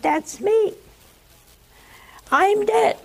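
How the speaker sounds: high, astonished cry; high-pitched shock at seeing yourself; stunned realization. Retold or imitated. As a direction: gasp it out as you realize you're dead. Man sees his own body.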